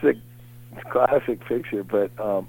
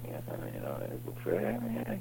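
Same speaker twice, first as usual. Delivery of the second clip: very quiet, low mutter; low mumble; 15 dB quieter, faster than usual. Retold or imitated. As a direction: mumble it under your breath, imitating your own thoughts. Inner reply on seeing Will Ferrell.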